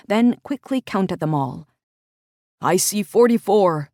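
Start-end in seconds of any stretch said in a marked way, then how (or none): 2.6-3.9 louder, called across the yard; calling out. Acted. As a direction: call it out to her. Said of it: "he called out eventually".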